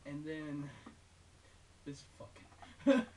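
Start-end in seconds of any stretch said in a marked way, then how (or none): 1.2-3.0 words squeezed out through real laughter, louder and slow; barely holding it together while laughing. Real, not acted. Reviewer cracking up as he introduces the silly game, laughing.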